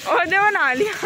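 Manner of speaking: high excited exclaim; excited call. Real, not acted. Vlogger teasing Dev who got soaked, high excited call. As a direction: call it out with glee.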